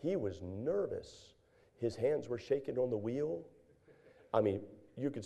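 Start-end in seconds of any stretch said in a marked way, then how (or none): 0.0-1.3 quiet and slow, leaning on each word; a hushed, drawn-out aside to the congregation; slow hushed emphasis. Real, not acted. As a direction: drop the voice and drag it out for effect. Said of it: "Now, stay with me now".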